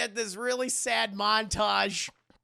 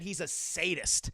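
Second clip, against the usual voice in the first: ordinary delivery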